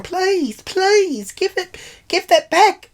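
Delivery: high-pitched wailing plea; hammed-up blubbering; mock crying. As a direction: imitate a tearful old man begging, way up high. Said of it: "he starts fucking crying".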